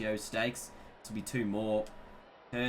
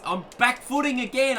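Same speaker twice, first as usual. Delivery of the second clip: much higher, louder burst on back footing again; yelped, pitch jumping up; excited exclamation; 10 semitones higher, 6 dB louder than usual. Real, not acted. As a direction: blurt it out in surprised excitement. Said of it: "I swear I'm, I'm back footing".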